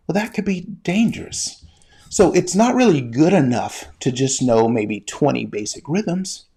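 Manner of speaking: ordinary talk, nothing marked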